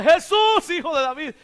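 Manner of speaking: sudden loud, high cry on the name. Retold or imitated. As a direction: imitate the beggar turning up the volume. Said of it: "sube el tono".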